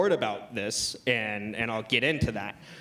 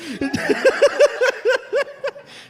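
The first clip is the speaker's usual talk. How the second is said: loud laugh; 8 dB louder than usual. Real, not acted. Crowd roar reaction, big laugh.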